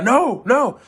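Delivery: high, loud mock-panicked protest; panicked refusal. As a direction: cry out as if holding the door shut. Holding door against police.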